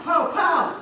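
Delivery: loud, high punch noises; punchy boasting. Acted. Father boasting he will hit the cat, punchy boasting. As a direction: punch each word out like a blow.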